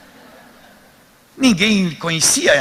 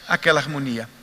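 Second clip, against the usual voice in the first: normal talking voice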